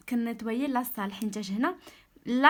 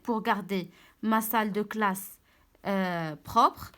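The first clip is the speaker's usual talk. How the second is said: slow, clear sentence reading; slow read-aloud; much slower than usual. Shown as a demonstration. Teacher rereading the sentence to pupils, slow and clear. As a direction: read it slowly so pupils follow. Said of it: "teacher rereads the sentence".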